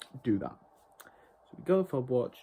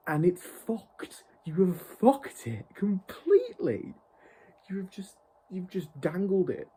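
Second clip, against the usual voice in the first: louder and higher, dragging each word out in anger; furious slow shout; 6 semitones higher, 5 dB louder, slower than usual. Real, not acted. Fan yelling at the showrunner, real fury. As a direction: spit it out slowly, full of rage.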